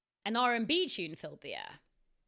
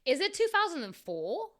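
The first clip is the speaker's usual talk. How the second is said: higher surprised question; 8 semitones higher, 4 dB louder than usual. A narrator's reading. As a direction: read it as an incredulous query. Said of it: "I asked, surprised".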